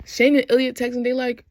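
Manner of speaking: normal talking voice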